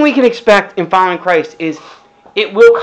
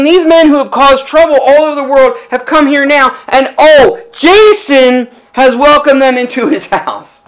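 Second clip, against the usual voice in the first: raised voice, a touch higher; shouted accusation; 5 semitones higher, 4 dB louder than usual. Acted. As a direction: declaim it like an angry crowd's charge.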